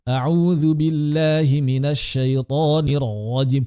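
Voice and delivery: slow recitation, rajim with a throaty r; wrong throaty ro. Shown as a demonstration. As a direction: recite with the r pulled back into the throat. (bacaan ro dari tenggorokan)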